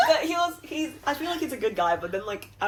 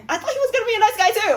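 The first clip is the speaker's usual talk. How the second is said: laughing; 12 semitones higher, 7 dB louder, faster than usual. Real, not acted. Laughing while saying it.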